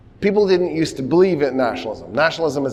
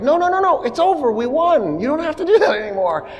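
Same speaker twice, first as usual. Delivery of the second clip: high, fast, frantic pleading; high nervous begging; pleading leaders; 12 semitones higher than usual. Acted. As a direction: squeak it out like panicked officials begging the crowd.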